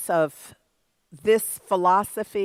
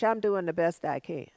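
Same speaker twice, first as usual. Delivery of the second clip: meek excuse; 6 semitones lower, 6 dB quieter than usual. Retold or imitated. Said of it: "try telling the coach".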